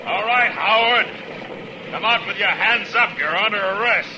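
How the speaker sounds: calling out. Acted. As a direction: project it to a hidden gunman. Calling out to gunman at tree.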